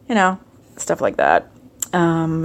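ordinary talk

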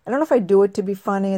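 ordinary talk

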